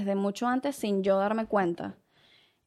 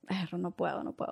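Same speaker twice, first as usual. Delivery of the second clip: faint moan; 3 semitones lower, 7 dB quieter than usual. Retold or imitated. Retold distress, very soft.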